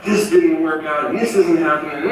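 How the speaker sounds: re-enacted frustrated griping over the phone; retold frustration. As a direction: drag out the complaint in mock exasperation. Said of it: "I was super frustrated".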